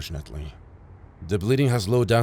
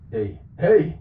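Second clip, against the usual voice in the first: alarmed call; 7 semitones higher, 4 dB louder than usual. Acted. Patient fading, medic alarmed.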